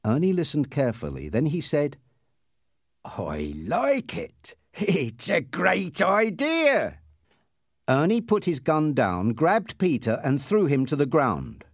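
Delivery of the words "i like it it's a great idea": drawn-out villainous glee; gloating bully. Acted. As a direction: savour a wicked idea word by word. Bully gloats over cruel plan.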